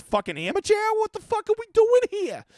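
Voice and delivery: hammed-up ranting at chat; mock outrage. Mock rant over missing likes.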